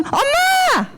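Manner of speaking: put-on high, drawn-out child's call; imitated calling child. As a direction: mimic a child calling out to mom. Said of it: "예준이가 방금".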